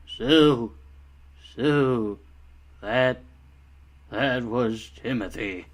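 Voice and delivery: bored non-reaction, halting and flat; mock an apathetic actor, long pauses between words; Toby. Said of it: "this is how he reacted".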